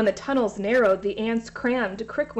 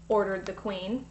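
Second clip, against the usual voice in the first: ordinary delivery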